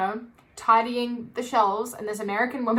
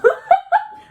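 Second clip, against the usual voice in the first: a much higher, louder laugh; high burst of laughter; 9 dB louder than usual. Real, not acted. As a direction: crack up at yourself. Laughing at sounding horrible.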